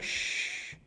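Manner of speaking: whispered shushing, then soft low calming; mock-gentle Jesus hushing the sea. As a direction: soothe a child back to calm. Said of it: "he's like".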